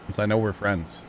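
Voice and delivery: ordinary talk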